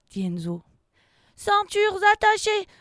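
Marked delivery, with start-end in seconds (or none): 1.4-2.7 very high, loud crew reply; called-out confirmation. Acted. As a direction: sing out that it's done. Crew mate confirms captain's order. (réponse de matelot, forte et aiguë)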